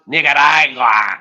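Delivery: NICARAGUA said loudly with a hammed-up rolling flourish; loud, showy mock-correct way of saying Nicaragua; exaggerated pronunciation. Shown as a demonstration. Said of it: "I think it's pronounced".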